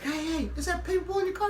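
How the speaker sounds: put-on high, quick, softened kid voice; excited kid. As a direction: pipe up like an eager boy. Voices the son spotting Paperboy.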